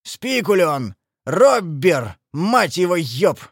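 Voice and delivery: punched-out, growled insults; snarling, forceful cursing; angry growl. A narrator's reading. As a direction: spit out accusations one by one. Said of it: "зарычал Большой Гонзо".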